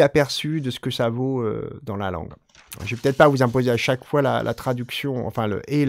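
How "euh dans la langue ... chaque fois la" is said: normal talking voice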